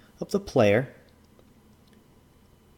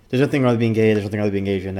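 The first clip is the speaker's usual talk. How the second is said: normal talking voice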